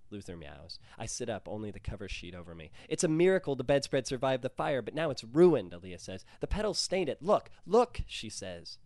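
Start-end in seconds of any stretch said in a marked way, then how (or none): 2.9-5.7 loud, high and upset; distraught. Acted. Girlfriend wailing over her grandmother's ruined bedspread, distraught. 6.4-8.0 high, loud and pleading; distraught. Acted. Girlfriend pleading with him to look at the stain, distraught. Aaliyah showing the stained bedspread.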